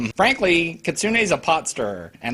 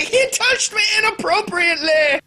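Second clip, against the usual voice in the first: mock accusation. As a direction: cry foul like a scheming girl. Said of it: "Kitsune's like".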